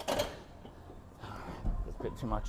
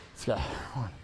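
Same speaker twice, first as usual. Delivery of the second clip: ordinary delivery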